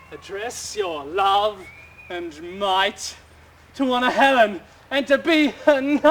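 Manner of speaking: a knightly oath, then a laugh; rising vow. Acted. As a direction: swear it with mounting fervour. Lysander vowing to Helena.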